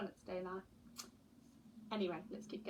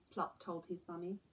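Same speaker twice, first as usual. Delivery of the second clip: ordinary delivery, nothing marked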